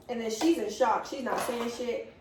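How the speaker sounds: ordinary delivery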